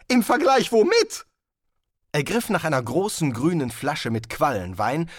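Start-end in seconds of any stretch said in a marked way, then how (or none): 0.1-1.3 higher and louder, indignant demand. Acted. Wizard indignant at the guest master, higher and louder. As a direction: demand an answer.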